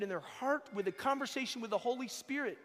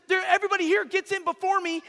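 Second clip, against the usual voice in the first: loud, high, fast blaming; a sick man grumbling at the crowd; bitter complaint; 8 semitones higher, 11 dB louder, faster than usual. Acted. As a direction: point the finger and gripe. Crippled man blames the crowd.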